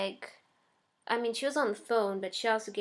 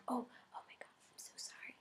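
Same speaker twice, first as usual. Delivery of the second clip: breathy whisper, softer, as the embarrassed neighbor; whispered flustered apology; 3 semitones higher, 11 dB quieter, faster than usual. Retold or imitated. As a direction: hush it out like she's caught off guard. Neighbor apologizing about left keys.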